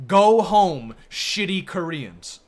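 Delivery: loud, drawn-out mock yell; imitated angry shout. Streamer repeating the line that stuck with him, loud imitated shout. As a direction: belt it out like a street heckler. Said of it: "that stuck with me".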